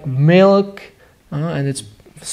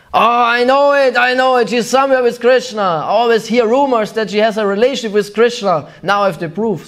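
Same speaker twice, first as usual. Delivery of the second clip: high, triumphant accusing voice; suspicious mother-in-law; 5 semitones higher than usual. Retold or imitated. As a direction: catch her out, gleeful and sharp. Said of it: "if Jatila would come, she".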